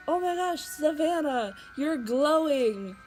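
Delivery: high, loud retold amazement, last words stretched out; re-enacted excited gasp of wonder; amazed exclamation. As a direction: gush in astonishment, drawing out the end. Retells own amazed exclamation to sister.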